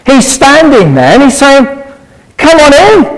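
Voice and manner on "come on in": high, inviting call. Acted. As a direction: beckon them inside with a lifted voice. Acts God calling people in.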